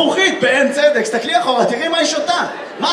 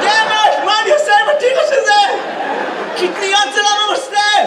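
mock drunken protest; 9 semitones higher than usual. Audience member's drunk outburst.

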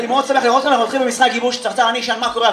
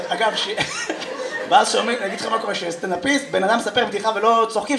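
usual speaking voice

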